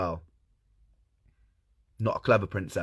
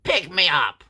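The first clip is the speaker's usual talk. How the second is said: high, loud frog shout; demanding frog; 8 semitones higher, 7 dB louder than usual. Acted. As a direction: yell up as a tiny bossy frog. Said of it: "so he reaches up and goes".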